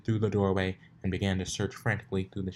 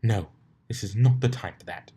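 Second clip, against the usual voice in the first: louder, stern self-scolding; firm refusal; 7 dB louder than usual. A narrator's reading. Rarity stamps her hoof and scolds herself firmly. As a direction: put your hoof down. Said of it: "She stamped her hoof".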